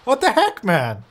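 high, drawn-out what the heck; indignant surprise. Real, not acted. Gamer to villager leaving, high mock-offended surprise. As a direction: pretend offence at a villager walking off.

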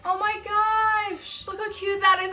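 slow, high oh my gosh; slow high cooing; delighted. Real, not acted. Seeing cute mug.

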